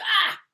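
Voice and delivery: loud, high imitated yelp; startled yelp. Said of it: "and you're like".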